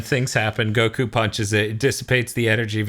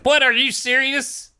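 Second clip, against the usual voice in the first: high, stretched-out incredulous cry; mock disbelief; slower than usual. Everyone reacting to the villain falling asleep, mock disbelief. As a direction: imitate a whole crowd's exasperated shock. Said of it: "everyone's like".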